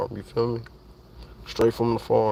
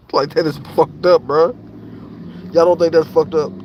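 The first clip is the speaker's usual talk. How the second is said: indignant outburst; 5 semitones higher, 5 dB louder than usual. Real, not acted. Outrage at animal cruelty.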